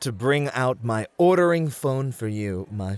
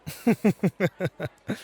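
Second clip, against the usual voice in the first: light chuckle; 8 semitones higher than usual. Acted. Host amused by her teasing, a short light laugh.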